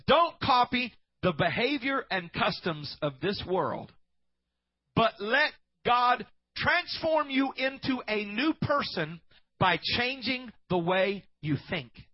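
slow, weighty Bible recitation; solemn scripture reading. Acted. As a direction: read the verse slowly and loudly. Reads scripture slowly.

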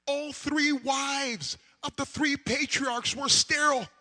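loud, high preacher's cry building to a roar; preaching at full force. Acted.

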